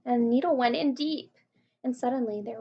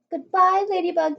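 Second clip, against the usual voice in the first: gasped goodbye; 7 semitones higher, 8 dB louder than usual. A narrator's reading. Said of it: "gasped James".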